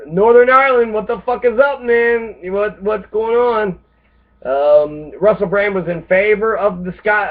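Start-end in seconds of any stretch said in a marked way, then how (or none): 0.1-3.8 higher, louder jokey hail, stretched out slow; mock hearty greeting. Greeting Northern Ireland as a buddy, hammed-up hail.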